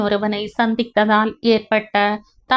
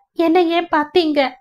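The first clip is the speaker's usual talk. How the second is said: distraught wail; 7 semitones higher than usual. Acted. Wife's distraught outburst.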